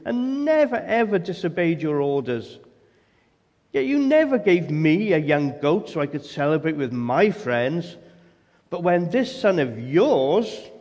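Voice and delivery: loud, jabbing, indignant complaint; angry. Acted. Older son angrily confronting his father, loud and jabbing. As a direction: poke the father in the chest with every word. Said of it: "as he says angrily".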